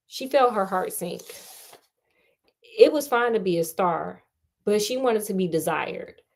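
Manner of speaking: ordinary delivery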